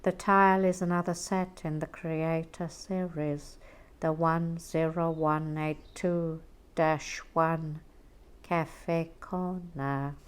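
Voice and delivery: slowly quoting the product code; slow quoted correction. Retold or imitated. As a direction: read out slow and deliberate. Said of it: "He said".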